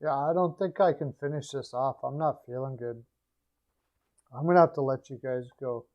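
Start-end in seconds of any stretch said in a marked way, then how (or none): none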